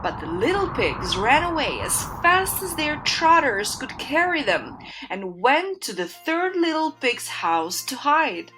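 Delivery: ordinary delivery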